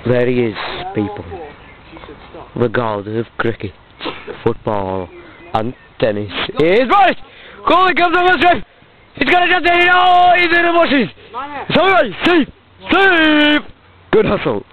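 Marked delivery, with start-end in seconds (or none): 0.1-6.3 very low, soft reverent hush; hushed commentary. Pretend. Commentator spotting the god of cricket, hushed commentary. 6.6-13.6 higher-pitched thrilled match call; excited play-by-play. Pretend. Commentator watching goalie chase a chance, excited play-by-play.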